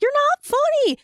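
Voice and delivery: a sudden high, loud outburst at the comic; drunk heckle. Retold or imitated. Woman on a first date snaps at the comic, high loud outburst.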